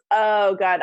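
normal talking voice

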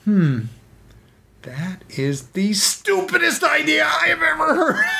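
very high, shouted villain scorn; Penguin scoffing. Retold or imitated. Penguin mocking his minion's plan, high scornful shout. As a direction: screech your contempt at the dumb idea. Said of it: "The Penguin goes".

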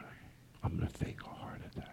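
conspiratorial whisper. Acted. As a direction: whisper the plan. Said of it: "I hear you guys whispering".

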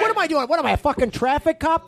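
high, outraged protest; indignant. Acted. Bandleader refusing to signal band, high indignant protest. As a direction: protest as if insulted.

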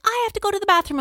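put-on a child who suddenly has to go; urgent kid. Mom voicing her older daughter.

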